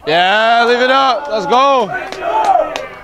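high, loud excited yelling; excited shout. Real, not acted. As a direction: shout the ball out. Shouting at the play.

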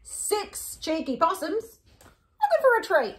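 slow, lilting storybook read; reading to children. Acted. Hosts reading to kids at home, storybook voice.

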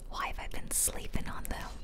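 ordinary talk, nothing marked

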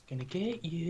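creepy stalker voice. Acted. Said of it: "creepy hide and seek stalker voice".

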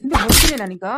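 stern, loud telling-off; angry scolding. Acted. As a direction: scold the child with a sudden loud burst. Angry elder scolds the child.

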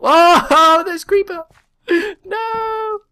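high nervous laughing yelps; panicked giggling; frightened squeal at a creeper; scared laughing. Real, not acted. Creeper in dark mine.

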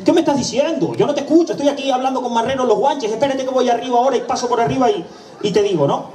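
voz aguda de chica hablando rápido por teléfono; agencia al teléfono. Acted. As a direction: una empleada distraída que contesta alto. (empleada canaria al teléfono, voz mucho más aguda)

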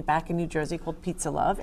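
ordinary talk

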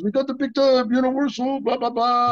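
imitating Jean-Claude gushing about the deal; light impression of a thrilled friend; Jean-Claude excited. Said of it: "He says".

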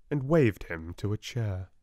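ordinary delivery

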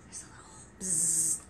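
soft secret whisper. Acted. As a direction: whisper it to the little ones. Whispering to the kids.

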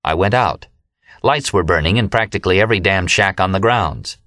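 usual speaking voice

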